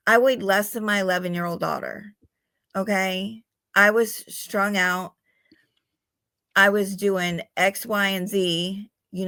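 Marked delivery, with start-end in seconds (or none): none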